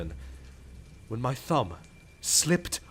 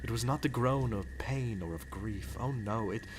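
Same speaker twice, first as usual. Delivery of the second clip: hushed dread; 7 semitones lower, 8 dB quieter than usual. Acted. Recounting the groan of terror.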